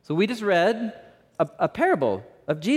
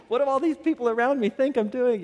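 higher-pitched, mildly alarmed inner voice; mock worried thought; 8 semitones higher than usual. Camping dad worried about neighbouring campers, mock alarm. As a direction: act out an embarrassed dad's worry. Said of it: "and he's thinking".

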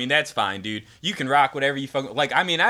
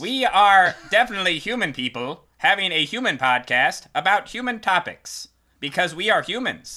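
alien voice; 6 semitones higher, 4 dB louder than usual. Acted. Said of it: "you went with that bit".

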